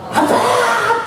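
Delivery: high, bright joyful call of daddy; joyful cry. Shown as a demonstration. Preacher shows the congregation calling God daddy, high and joyful. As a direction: cry it out like a happy child.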